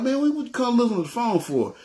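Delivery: high-pitched, put-out questioning; mock complaint. Host asking date about looking at phone, put out. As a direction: whine it lightly, pitch way up. Acted challenge to his date.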